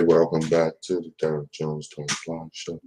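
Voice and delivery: low, smooth show-host announcer. Shown as a demonstration. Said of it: "in my announcer voice".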